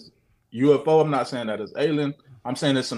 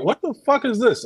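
high-pitched baffled outburst, retold; what on earth is that, pitched way up; startled disbelief; 10 semitones higher than usual. Man retells spotting a star on the ground, startled disbelief.

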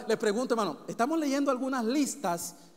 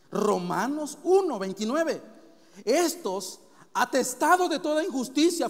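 raised, emphatic preacher's list; forceful scripture reading; 3 semitones higher, 5 dB louder than usual. Real, not acted. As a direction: hammer out each sin in a steady run.

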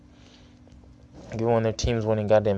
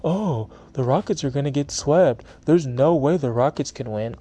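lightly voiced smug rival fan, a bit higher; mocking trash talk; 5 semitones higher than usual. Podcaster mocks rival fans' trash talk, mildly smug voice. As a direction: parrot the doubters with a sneer. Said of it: "All that talk I'd been hearing".

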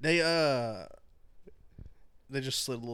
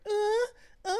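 high mock moaning "uh, uh", mimicking a friend; retold friend on the couch, mock moaning. Telling how Isaac moaned at the door, high mock moans. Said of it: "like, doing dumb shit".

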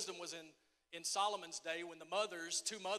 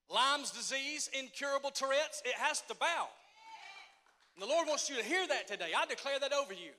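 loud, high declaration of healing; preaching at full force; 5 semitones higher, 6 dB louder than usual. Acted. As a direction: proclaim it over the crowd.

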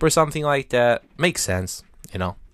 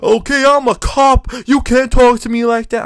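high, loud mock cop outburst; hammed-up furious cop; angry cop; 11 semitones higher, 7 dB louder than usual. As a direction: bellow it like an outraged off-duty cop. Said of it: "And he's like".

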